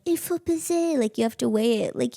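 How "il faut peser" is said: high-pitched imitation of a French-speaking clerk; Swiss shop woman. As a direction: chirp it as the insistent shop woman. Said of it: "the woman's like".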